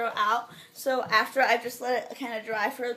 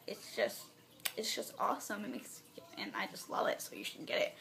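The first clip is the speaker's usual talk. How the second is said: soft, nearly voiceless, breath running out; 3 semitones lower, 11 dB quieter than usual. Real, not acted. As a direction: let the air give out as you talk. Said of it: "I'm running out of breath".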